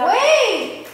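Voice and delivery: sudden high shout to stop; loud shout. Real, not acted. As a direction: yell it out to halt him. Stops friend mid-challenge.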